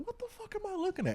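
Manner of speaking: very high, quick, thin-volume squeal of disbelief; retold disbelief. Reliving a sight through the glasses, high-pitched mock disbelief. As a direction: squeak it out, baffled. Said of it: "I literally said this out loud".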